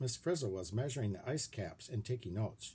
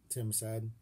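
plain reading voice

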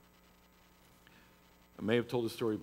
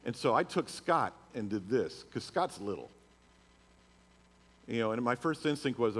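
ordinary delivery

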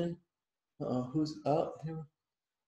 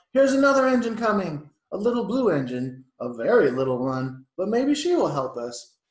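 high, loud hopeful call; 4 semitones higher, 9 dB louder than usual. A narrator's reading. Toy clown spotting another engine, calling out. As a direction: call out to the others. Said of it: "clown called out".

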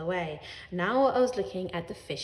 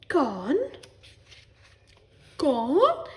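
higher, louder questioning echo; feigned astonishment; 6 semitones higher, 6 dB louder than usual. Acted. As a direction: echo it in put-on disbelief. Parents echo in feigned shock.

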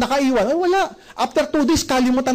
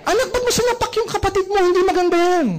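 higher-pitched exasperated mum voice; annoyed parent scolding; 8 semitones higher, faster than usual. Retold or imitated. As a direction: scold the noisy kid, fed up.

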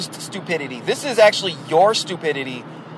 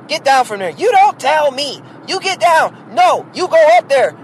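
put-on higher, louder scolding of the kids; yelling mom; 9 semitones higher, 5 dB louder than usual. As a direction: bark orders at the bickering kids.

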